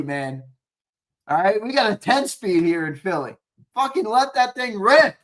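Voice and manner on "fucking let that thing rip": fired-up urging. Real, not acted. Urging the coach.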